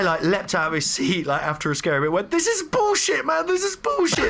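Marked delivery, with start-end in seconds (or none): 2.3-4.3 high, near-tearful yell; scared crying outburst. Retold or imitated. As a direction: cry it out, half-sobbing from fright. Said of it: "Like, crying".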